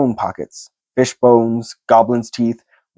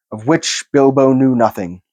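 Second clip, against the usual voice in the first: ordinary delivery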